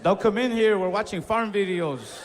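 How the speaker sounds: yelling through the door, covering up; shouting through the door. Retold or imitated. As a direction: call out to keep her away. Yelling to the mom.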